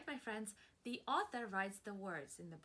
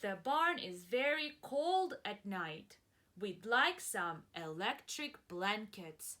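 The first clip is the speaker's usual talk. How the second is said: slow, louder careful reread; rereading a letter; 5 dB louder, slower than usual. Shown as a demonstration. Teacher rereading the cows' letter, slow and careful. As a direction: read it again slowly and clearly. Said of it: "read it again".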